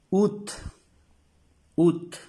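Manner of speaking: "Ut" said loud and high, oot; French août. Shown as a demonstration. Teacher to class, loud French "Ut". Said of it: "Cómo se pronuncia".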